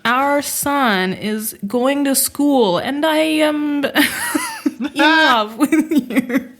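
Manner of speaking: hammed-up bad Italian accent, slow, breaking into laughter; mock the mistress's Italian accent, laughing through it. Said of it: "mistress who's like".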